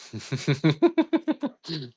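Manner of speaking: high, rippling giggle; giggling. Real, not acted. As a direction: let out a long high chuckle. Laughs at feeling better.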